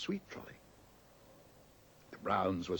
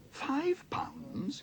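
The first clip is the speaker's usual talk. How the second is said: much higher, startled squeak; shocked exclamation. A narrator's reading. Paddington shocked by the price of the carpentry set. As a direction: gasp at the price. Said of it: "exclaimed Paddington".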